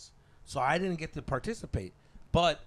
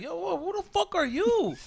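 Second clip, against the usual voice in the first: high, louder comeback; mock indignant challenge; 6 dB louder than usual. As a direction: throw the challenge back at him. Acts out challenging the angry stranger.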